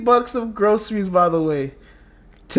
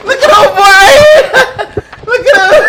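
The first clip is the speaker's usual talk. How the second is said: high, loud laughing shout; real hysterical laughter, voice shooting up; shrieking with laughter; 11 semitones higher, 8 dB louder than usual. Real, not acted. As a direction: yell it out while cracking up. Cracking up, pointing someone out.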